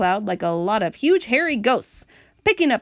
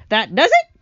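loud, higher exclamation; crying out; 4 semitones higher, 8 dB louder than usual. A narrator's reading. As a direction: burst out as the dismayed earthworm. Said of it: "cried the earthworm".

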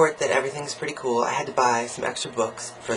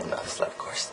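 whispered aside; 10 dB quieter than usual. Real, not acted.

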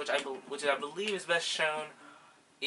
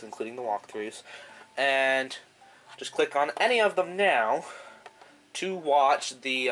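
announcer voice; 7 dB louder than usual. Acted. Outro announcer read.

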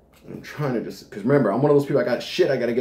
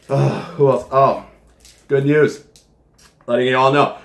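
usual speaking voice

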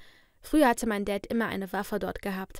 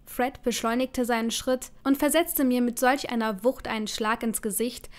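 plain reading voice

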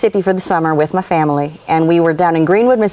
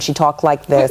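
lower-pitched imitation of a New York teacher; New York accent; 5 semitones lower than usual. Pupil recalling her first-grade teacher, imitated New York accent. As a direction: mimic how a New Yorker talks. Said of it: "she was from New York".

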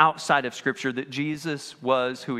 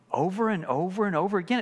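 ordinary talk

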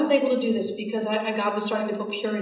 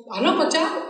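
higher, shrugging mock answer; offhand reply; 7 semitones higher, faster than usual. Telling her friend she doesn't know why, a higher, shrugging tone. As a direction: toss it off lightly. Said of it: "I'm like".

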